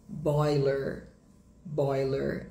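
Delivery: American "boiler", final R sounded; slow, clear American R ending on "boiler"; sotaque americano. Shown as a demonstration. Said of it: "em inglês americano". (sotaque americano, R final pronunciado)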